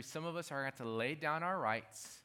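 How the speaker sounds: ordinary talk, nothing marked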